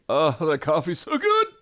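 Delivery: loud laughing relish; laughing satisfied. Real, not acted. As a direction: savor the coffee with a laugh. Savoring coffee.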